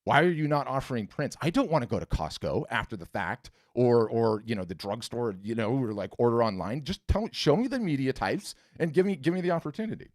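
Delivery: half-shouted retold tirade at a friend; retold exasperated scream. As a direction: plead and scold in a hammed-up complaint. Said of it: "And I scream at her".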